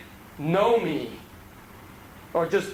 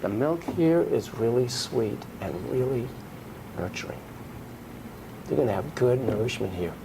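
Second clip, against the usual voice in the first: soothing, nurturing; 6 semitones lower than usual. Retold or imitated. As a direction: soothe him like a nurturing mother. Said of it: "just as John Rosen would say".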